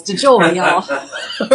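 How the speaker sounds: punchy Southern twang, tipping into a laugh; Southern accent. Shown as a demonstration. Host showing viewers the brand name sounds Southern, in a Southern accent. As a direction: play up a loud Southern drawl on the name. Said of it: "it just sounds Southern".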